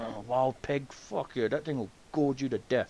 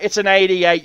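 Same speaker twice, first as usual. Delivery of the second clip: exasperated retort; 6 semitones higher, 10 dB louder than usual. Retold or imitated. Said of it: "I'm like".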